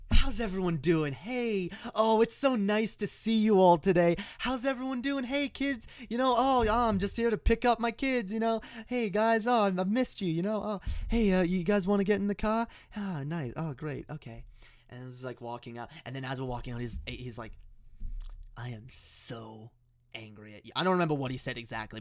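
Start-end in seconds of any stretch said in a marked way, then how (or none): 0.1-14.4 high-pitched, gushing public friendliness; mock holy dad. Dad greeting everyone in public before picking up kids, mock holy. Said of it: "very holy when he's not alone". 18.5-20.7 low, slow, hushed seething; angry whispering. Retold or imitated. Dad privately scolding his kids while walking out, angry whisper. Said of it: "whispering stuff like".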